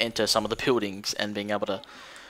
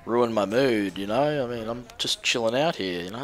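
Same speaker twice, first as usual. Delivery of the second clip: hippie on drugs; slower than usual. Retold or imitated. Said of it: "sounded like he was a hippie on drugs".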